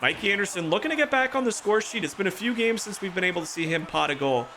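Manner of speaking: lively sportscaster calling the game; play-by-play commentary. Acted. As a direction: call it like a hockey broadcaster.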